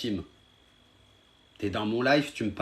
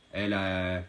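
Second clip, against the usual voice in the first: ordinary talk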